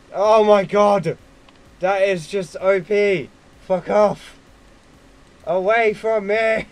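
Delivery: loud, slow, shouted panic at the screen; gaming outburst. Real, not acted. Swamped by shapes, yelling at the game. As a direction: yell it as the enemies close in.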